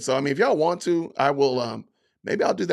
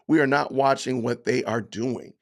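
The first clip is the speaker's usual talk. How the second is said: ordinary talk, nothing marked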